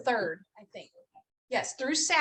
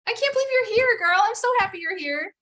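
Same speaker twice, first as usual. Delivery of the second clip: much higher, louder, gushing welcome to a friend; excited greeting; 11 semitones higher, 7 dB louder than usual. Real, not acted. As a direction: squeal with happy surprise. Greeting a dear friend warmly.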